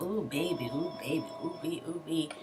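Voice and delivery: soft, seductive cooing. Retold or imitated. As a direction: coo at him to seduce. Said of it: "touches on his face".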